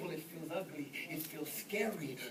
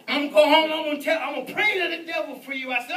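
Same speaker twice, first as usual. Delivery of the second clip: loud, pitched-up, rushed retort; mock threat; 9 semitones higher, 15 dB louder, faster than usual. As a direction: shout the threat back in comic indignation. Retold threat replayed loudly.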